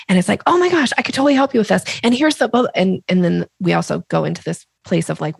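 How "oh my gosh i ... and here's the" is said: fast and high, gushing eagerly; dog calling energy. Shown as a demonstration. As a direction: rush the pitch like an overeager seller. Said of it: "what I call dog calling energy".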